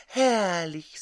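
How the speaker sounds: ordinary delivery, nothing marked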